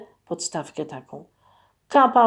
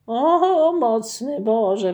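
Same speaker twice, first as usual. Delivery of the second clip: higher, louder dismayed cry, slowing down; distressed exclamation; 3 semitones higher, 4 dB louder, much slower than usual. Acted. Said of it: "wykrzyknął Maciek strapiony". (strapiony okrzyk, wyżej i głośniej)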